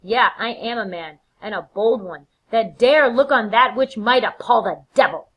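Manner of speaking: bold boast building to loud and high; defiant Macbeth. Acted. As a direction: declare your courage, swelling to the last word. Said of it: "And he's like".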